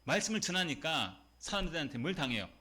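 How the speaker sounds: normal talking voice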